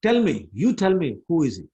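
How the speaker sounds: acted exasperation, bearing down on each demand; angrier demand. Mother challenging Balram about Krishna, angry. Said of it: "was just even more angry".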